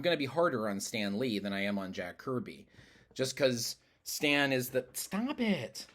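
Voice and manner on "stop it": sudden high-pitched aside; scolding a pet. Real, not acted. Speaker to misbehaving cat mid-answer, high-pitched scolding. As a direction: break off to tell the cat off in a high voice.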